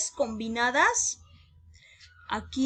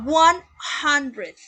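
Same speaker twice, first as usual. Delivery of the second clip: slow, loud English count for the class; teacher drilling one hundred to four hundred; 4 semitones higher, 7 dB louder, much slower than usual. Shown as a demonstration. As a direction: call out each number, then hundred. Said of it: "diríamos one hundred".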